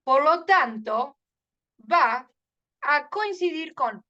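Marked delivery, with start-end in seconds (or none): none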